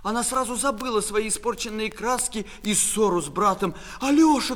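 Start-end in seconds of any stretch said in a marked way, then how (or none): 4.0-4.6 high, loud call of a name; alarmed shout. Acted. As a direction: cry out for your fallen brother. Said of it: "кричала она".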